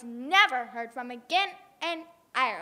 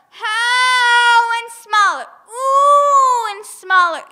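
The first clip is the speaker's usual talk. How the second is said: slow, high, loud howling words; loud high wailing that fades; howling shrinking; 8 semitones higher, 14 dB louder, much slower than usual. Acted. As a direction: howl as you shrink away. Giant howling while shrinking.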